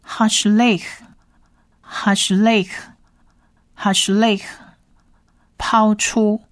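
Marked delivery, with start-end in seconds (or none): 0.1-4.4 "Hashlech" stretched very slowly, three times; slow model reading. Shown as a demonstration. Teacher models "Hashlech" for listeners, very slow. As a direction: draw out "Hashlech" syllable by syllable. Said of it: "我们从第一列来".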